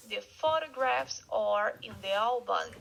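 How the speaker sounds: the photographs are in the album, stress on photographs; model sentence. Shown as a demonstration. Teacher repeats the example sentence for students. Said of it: "One more time".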